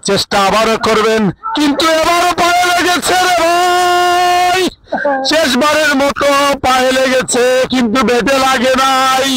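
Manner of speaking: comic cricket commentary. Acted. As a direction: ham up the commentary for laughs. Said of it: "দর্শককে হাসিয়েছেন". (মজার ধারাভাষ্য)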